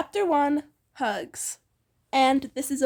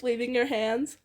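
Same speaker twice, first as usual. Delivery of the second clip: ordinary delivery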